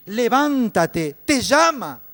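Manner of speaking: high, drawn-out shout; rousing call. Acted. Crowd telling the blind man to get up, rousing shout. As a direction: call it out at full voice to rouse someone.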